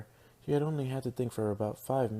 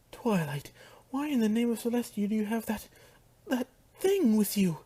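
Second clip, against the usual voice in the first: high, horrified shriek rising louder; shrill outburst, loudest at the end; 11 semitones higher, 5 dB louder than usual. Acted. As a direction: demand an answer in shrill horror. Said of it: "Rarity said shrilly".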